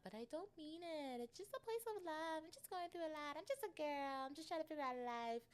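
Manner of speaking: high, whiny-sweet put-on excuse; mock pleading. Excusing herself for snapping, mock pleading. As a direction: plead your innocence in a high little voice.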